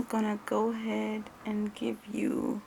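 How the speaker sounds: soft ASMR whisper, breath only. Acted. Roleplay tester whispers to client while preparing the test.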